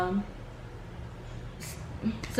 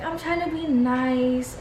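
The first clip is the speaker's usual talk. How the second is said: high, drawn-out weepy imitation; mock tearful; 4 semitones higher, slower than usual. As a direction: imitate pleading through tears. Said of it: "tears start coming down her face".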